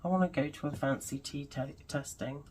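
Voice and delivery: ordinary talk, nothing marked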